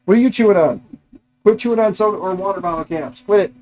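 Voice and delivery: exasperated pet-owner reprimand; scolding the dog. Real, not acted. Scolds dog chewing bottle caps.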